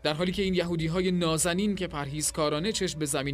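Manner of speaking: commander bellowing at the innkeeper; shouting in rage, pitch climbing high; angry shout. Acted. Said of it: "فریاد زد".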